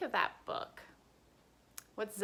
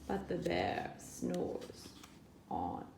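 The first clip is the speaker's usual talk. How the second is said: hushed; 4 semitones lower, 8 dB quieter, slower than usual. A narrator's reading. Sleeping bear refrain.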